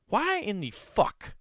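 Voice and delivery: drawn-out, high-pitched disbelief; incredulous. Real, not acted. Comic asking the audience why the guy told it, stunned. Incredulous about liar's story.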